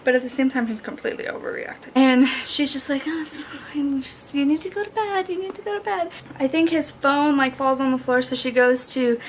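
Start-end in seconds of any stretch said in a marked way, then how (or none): 3.1-6.1 soft, higher voice; acted soothing. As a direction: lightly coax someone to bed, softly. Acts her soothing him to bed.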